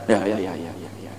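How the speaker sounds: low, soft, mumbled yes-yes of a customer; passive customer agreeing. Acted. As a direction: nod along with a low, quiet drone. Customer nodding along.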